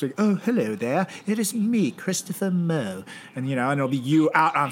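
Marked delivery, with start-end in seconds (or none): 0.2-3.0 higher, put-on British accent; British accent, slowing into the name; mock British accent. Host posing as his co-host from backstage, in a mock British accent. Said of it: "I'm pretty good with accents".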